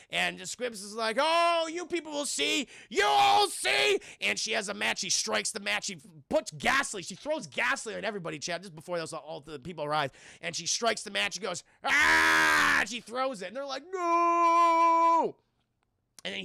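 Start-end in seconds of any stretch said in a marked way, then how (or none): 1.2-4.0 higher, louder mock threat; hammed-up villain yell. Mother threatening the townsfolk, hammed-up yell. 11.8-12.8 louder, higher short yell; mock battle cry. Mother throwing the match, mock yell. 13.9-15.4 higher, louder drawn-out no; mock horrified cry. Townsfolk seeing the match thrown, mock horror. Crowd sees the fire.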